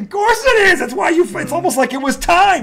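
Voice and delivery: fired up, almost yelling it's timed; exasperated outburst of agreement; worked-up agreement. Real, not acted. Ranting about the jinxed stat.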